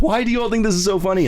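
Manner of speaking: high, fast mock exasperation; mock exasperated mom. Mom annoyed at her laughing family, high fast exasperation. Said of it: "finally she's like".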